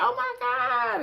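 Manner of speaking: high-pitched "Oh my God" of delight; delighted gasp. Retold or imitated. Imitating Evelyn reacting to Nathan's painting, delighted high gasp. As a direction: gush like she's touched by the picture.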